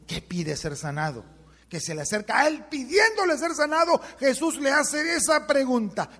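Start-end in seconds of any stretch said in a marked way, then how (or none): 2.0-6.1 preaching at full force. Real, not acted. Preacher drives the second way home to his listeners, full-force preaching. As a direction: raise the voice high and stretch the key words.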